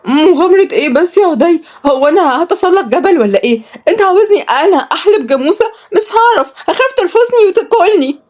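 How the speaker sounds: high, alarmed objection; panicky protest. Acted. Kenzi refusing to milk a buffalo, panicked. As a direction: rise into a squeaky fearful pitch.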